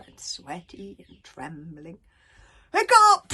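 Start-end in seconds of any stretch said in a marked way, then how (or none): 2.7-3.3 high, loud alarmed shout. A narrator's reading. Gobber calling for missing Hiccup, shouting. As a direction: yell the name out in alarm. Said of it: "shouted Gobber".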